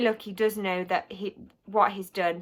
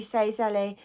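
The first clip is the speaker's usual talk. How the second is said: ordinary delivery, nothing marked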